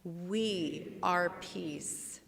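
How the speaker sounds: very slow, each word weighted; slow spoken mantra; slow affirmation. Shown as a demonstration. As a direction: lay down each word slowly. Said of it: "Say it with me".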